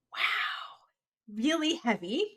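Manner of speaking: whisper. Real, not acted. Reacting to heavy backstory.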